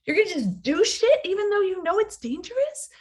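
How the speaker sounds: voicing fear's shocked outrage; incredulous fear voice. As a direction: play fear aghast at the plan. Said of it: "Your fear is like".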